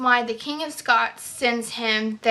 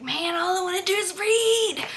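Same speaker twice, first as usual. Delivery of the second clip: whiny longing; 7 semitones higher than usual. Retold or imitated. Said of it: "I was like".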